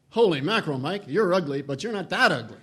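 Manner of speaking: loud, hearty bellow from a professor; booming voice. Retold or imitated. Chairman booming at his student across the cafeteria, hearty bellow. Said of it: "in a voice that could have been heard in College Park".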